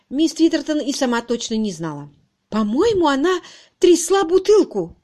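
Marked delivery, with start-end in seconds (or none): 2.5-5.0 high, loud, distraught blurt; sobbed. A narrator's reading. Miss Twitterton confessing about the bottle, tearful. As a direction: wail it out tearfully. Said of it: "всхлипнула она".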